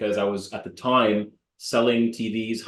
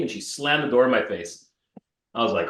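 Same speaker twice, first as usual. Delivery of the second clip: ordinary delivery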